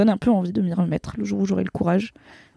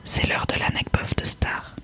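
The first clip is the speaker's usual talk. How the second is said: soft ASMR whisper; right in your ear; 8 dB quieter than usual. Acted. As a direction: breathe the words close to the mic. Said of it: "une partie A S M R dans le podcast".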